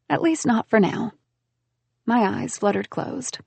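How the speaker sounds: ordinary delivery, nothing marked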